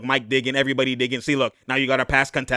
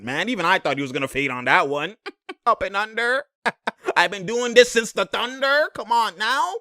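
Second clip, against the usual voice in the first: ordinary delivery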